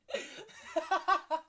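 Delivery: real high, loud cackling laugh; helpless laughter. Real, not acted. Actor breaking in a serious scene, helpless laughter. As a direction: lose it completely in a pealing laugh.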